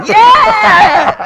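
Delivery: loud, high laughing yell; laughing shout. Real, not acted. Bursts out laughing at own story.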